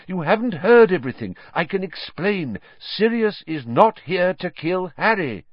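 urgent. A narrator's reading. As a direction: rush to explain, urgently. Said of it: "said Lupin urgently".

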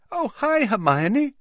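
very high, sing-out friendly greeting; beaming. A narrator's reading. Parvati greeting Hermione, beaming. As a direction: greet with a beaming lift in pitch. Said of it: "Parvati positively beamed".

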